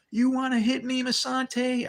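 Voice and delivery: high, loud barking drill instructor; drill sergeant yell. Retold or imitated. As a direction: scream it in the recruit's ear. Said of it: "He was yelling and screaming in my ear".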